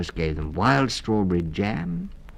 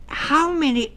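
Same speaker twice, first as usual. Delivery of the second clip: much higher, eager start of a question; eager child; 6 dB louder than usual. A narrator's reading. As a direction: cut off mid-question.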